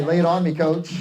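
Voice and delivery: "Lay it on me, coach" called out loud to the room; call-and-response line. Shown as a demonstration. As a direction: shout it back like a team.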